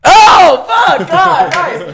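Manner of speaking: high, loud burst of amazement; real excited shout. Real, not acted. Player thrilled by his friend's instant guess, excited shout. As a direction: explode at the correct guess.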